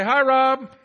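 called out high and loud; calling out. Real, not acted. Pastor leads the church greeting Rob, called out loud. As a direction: shout a cheerful hello across the room.

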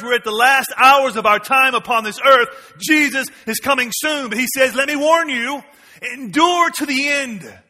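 loud, high urgent warning; full-force preaching. Acted. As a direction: sound the alarm to the church.

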